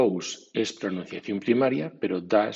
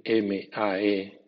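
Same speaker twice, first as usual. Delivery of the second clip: each letter spelled slowly and apart; spelling out letters; much slower than usual. Shown as a demonstration. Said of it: "o bien". (deletreo lento)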